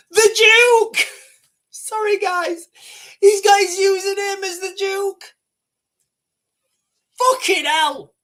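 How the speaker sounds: high, loud and cracking up; trying to talk while losing it laughing; real helpless laughter. Real, not acted. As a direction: blurt it out on the edge of hysterics. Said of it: "I shouldn't be laughing".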